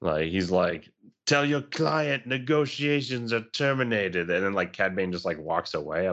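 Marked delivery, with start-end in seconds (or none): 1.2-4.3 slow, raised-pitch put-on voice; Boba. Host quotes Boba to co-hosts, slow put-on voice. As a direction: imitate him, higher and drawn out. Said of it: "when Boba was like".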